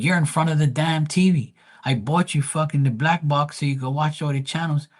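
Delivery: high-pitched mock exasperation; retold protest, pitched well up; exasperated retort. Son telling dad off over the TV, exasperated.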